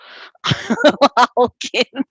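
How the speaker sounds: loud, high mock-villain cackle; gleeful laugh. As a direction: cackle like a villain being let loose. Gleeful cackle after "step aside.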